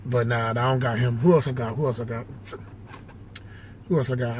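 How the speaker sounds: ordinary talk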